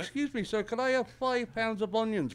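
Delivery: put-on high-pitched polite old lady; little old lady. Old lady asking a shopkeeper for onions, high old-lady voice. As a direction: pitch it up like a sweet-talking customer. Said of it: "a little old lady".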